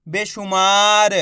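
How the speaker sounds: बेशुमार drawn out slowly, every syllable clear; slow, patient teacher blending; slow blended reading. Shown as a demonstration. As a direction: read the word slowly to a beginner. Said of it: "एक बार फिर से देखिए".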